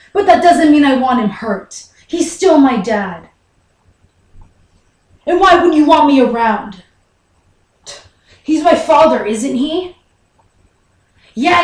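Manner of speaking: loud, hurt confrontation; indignant. Acted. Son confronts Mom for keeping Dad's illness secret, indignant. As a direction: demand answers with rising outrage.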